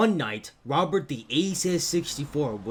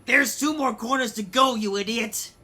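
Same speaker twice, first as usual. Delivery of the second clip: shrill yelling train; 11 semitones higher, 5 dB louder than usual. Acted. Angry train yelling insults.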